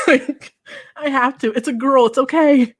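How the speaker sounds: put-on giggling through a child's begging; laughing plea. Little girl laughing as she pleads with her daddy. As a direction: laugh while pleading to keep it.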